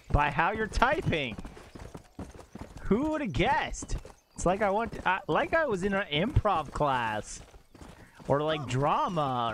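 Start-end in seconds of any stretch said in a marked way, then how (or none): 2.8-4.0 slow, high theatrical swoop; mock astonishment. Streamer hamming up a saying for chat, high mock astonishment. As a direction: draw it out in high mock wonder. Playful improv saying, drawn out.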